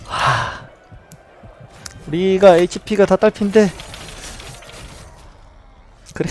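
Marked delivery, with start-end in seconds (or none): none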